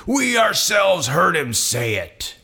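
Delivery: high, slow, emphatic condemnation; outraged declaration. Acted. Outraged council condemns Jesus.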